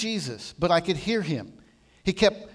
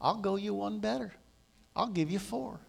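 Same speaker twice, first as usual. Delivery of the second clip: soft, gentle offer; sweetly smiling; 3 semitones higher, 8 dB quieter than usual. Retold or imitated. Jesus answering the protest without anger, sweetly smiling. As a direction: answer softly with a smile in it. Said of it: "He smiled so sweetly and said".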